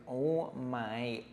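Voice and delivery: ordinary delivery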